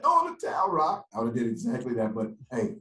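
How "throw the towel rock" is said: high, loud mock plea; comic high-pitched holler; Rocky movie cry. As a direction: shout to the boxer from his corner, hammed up. Replaying the Rocky movie line.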